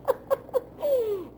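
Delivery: a high real burst of laughter. Real, not acted. Amused by Sam's hatred of her, high laugh. Laughs about the rattlesnake remark.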